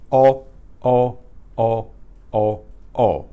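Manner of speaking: each O a steady Spanish vowel; O held level, no sing-song glide; pure single O. Shown as a demonstration. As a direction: repeat O as one pure sound. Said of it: "It's a pure single sound".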